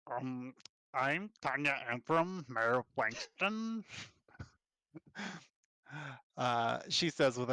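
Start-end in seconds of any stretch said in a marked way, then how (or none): 0.9-3.8 slow, drawn-out cartoon orcish twang; Lumpy Space Princess twang. Half-orc mayor introducing herself, slow cartoonish orcish twang. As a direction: stretch the words in an orcish twang. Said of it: "the orcish twang is like L S P from Adventure Time".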